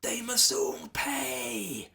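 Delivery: high, slow eerie whisper; screamed. A narrator's reading. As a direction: hiss it like a ghostly threat. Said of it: "screamed the voice".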